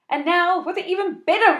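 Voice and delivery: high loud ringmaster call. Acted. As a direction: announce like a circus act. Echoes circus announcement.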